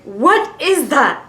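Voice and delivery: loud, drawn-out alarmed question; mock-alarmed, stretching each word; alarmed friend. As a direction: imitate a friend's shocked reaction, slowly. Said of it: "it made me panic".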